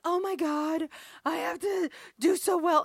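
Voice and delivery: mock-panicked wail of a stressed daughter; fretting daughter. Daughter fretting about her audition.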